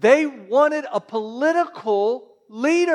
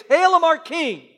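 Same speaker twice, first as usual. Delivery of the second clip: higher, louder hail; mock cheering crowd; 8 semitones higher, 6 dB louder than usual. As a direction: cheer him like a festival crowd.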